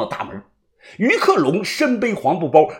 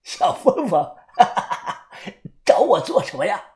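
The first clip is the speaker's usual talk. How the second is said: chuckling; 3 semitones higher than usual. Acted. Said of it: "他嘿嘿的笑着".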